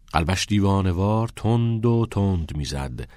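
ordinary delivery, nothing marked

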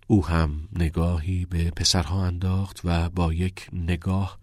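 ordinary delivery, nothing marked

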